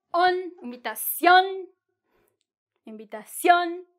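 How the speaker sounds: "invitación" with a long, strong final "ción"; stress on final syllable. Shown as a demonstration. Teacher showing the strong syllable of invitación, final stress. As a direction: draw out and hit the "ción" in "invitación". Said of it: "esa es la sílaba que está fuerte".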